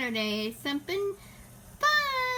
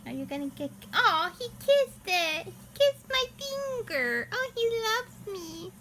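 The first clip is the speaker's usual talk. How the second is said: fond cooing pet talk; 3 semitones higher than usual. Real, not acted. Baby talk to her cat.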